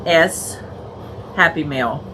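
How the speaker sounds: normal talking voice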